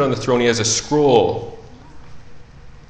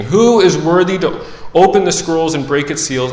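raised, forceful herald's challenge; loud proclamation; 4 semitones higher, 5 dB louder than usual. Acted. Mighty angel asks who can open scrolls, loudly proclaiming. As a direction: proclaim the question high and loud. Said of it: "proclaiming with a loud voice".

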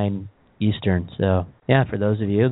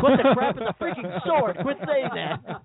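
exasperated outburst; 12 semitones higher than usual. Retold or imitated. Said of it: "and I'm like".

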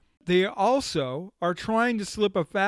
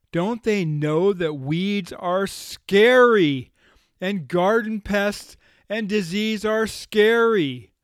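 slow, louder mock warning that weeds are scary; 4 semitones higher, 6 dB louder, slower than usual. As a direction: spell out the danger like a spooky ad. Mocking the ad's scare tone.

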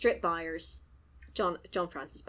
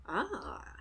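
normal talking voice

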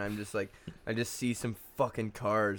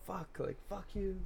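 higher, softer mock cursing; retold angry dad; 9 semitones higher, 7 dB quieter than usual. Son quoting dad at the woman, restrained mock anger. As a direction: imitate a mad dad without volume. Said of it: "He was just so mad".

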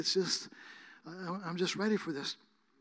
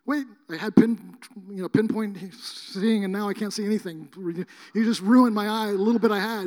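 mock complaint; 2 semitones higher, 6 dB louder than usual. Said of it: "I thought he would be like".

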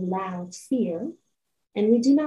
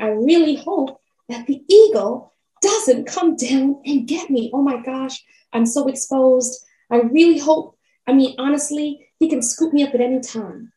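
higher, louder, panicked pleading; loud fearful rabbit; 4 semitones higher, 6 dB louder than usual. A narrator's reading. Rabbit fearfully calling out in the forest, loud panic. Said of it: "the more he started speaking out loud ... voice and volume go up".

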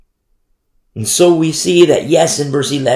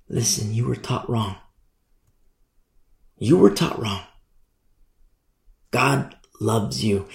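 soft reassurance; 3 semitones lower, 5 dB quieter than usual. Acted. Messenger reassures girl.

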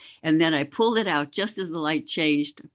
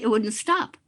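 ordinary delivery

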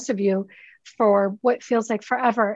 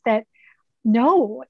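usual speaking voice

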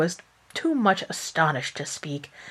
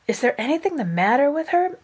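high anxious mother's question; a worried mother calling out, lightly voiced; anxious cry; 8 semitones higher than usual. Mother finding daughter gone, asks in alarm. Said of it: "cried Missus Dashwood".

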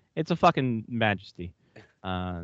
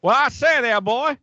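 high, loud southern rooster drawl; Foghorn Leghorn southern accent; 12 semitones higher, 5 dB louder than usual. Retold or imitated. Host switches to Foghorn Leghorn southern accent. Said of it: "more Foghorn Leghorn".

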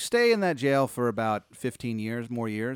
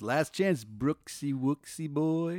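singsong last offer, slowing on the nickname; genie; 3 semitones higher, much slower than usual. Acted. Genie fading away with a last chance, slow and teasing. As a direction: dangle it, drawing out the pet name. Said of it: "He goes".

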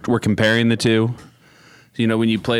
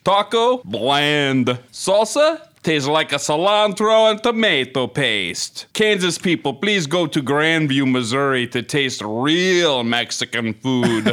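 mock Italian accent; 2 semitones higher, slower than usual. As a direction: overplay a comic Italian voice reading the complaint. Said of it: "it'll end up Italian".

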